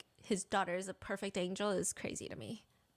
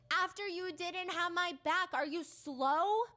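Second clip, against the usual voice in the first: slow, raised pitch leaning hard on SLOW; all-caps emphasis; 7 semitones higher, slower than usual. Acted. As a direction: BACK and SLOW drawn out, higher. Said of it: "this is, like, all in caps".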